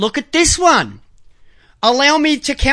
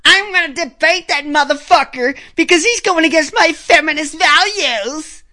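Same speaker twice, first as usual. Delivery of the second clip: higher-pitched mock imitation of an opponent; mimicked outburst, pitched up; mocking quote; 4 semitones higher than usual. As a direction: put on a high, indignant voice to mimic her. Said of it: "she said to Kyle".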